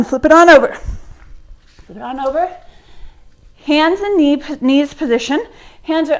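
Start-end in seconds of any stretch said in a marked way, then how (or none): 0.0-0.7 louder, pushed coaching call; loud cue. Real, not acted. Coach calling the class to flip over, loud. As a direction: call it out to the room.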